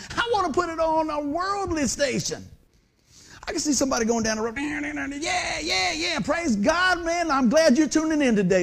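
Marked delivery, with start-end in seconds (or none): 4.6-8.6 high, cheering radio fan praising God; hammed-up excited fan shouting along; excited listener.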